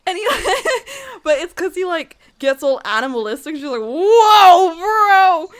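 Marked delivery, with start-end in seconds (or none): 3.8-5.5 high, loud, drawn-out mock alarm; shocked yell. She reacts to being pinned, shocked mock yell. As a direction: cry out in retold shock, half laughing.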